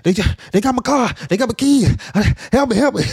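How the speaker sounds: acting out a frantic robbery victim; shocked panicked pleading. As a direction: blurt it out in a re-enacted panic. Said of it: "I'm just shocked".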